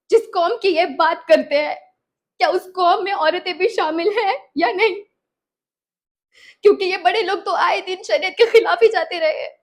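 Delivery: loud, forceful courtroom argument; indignant challenge. Acted. Courtroom challenge to the opposing side. (तीखी, ऊँची आवाज़ में चुनौती)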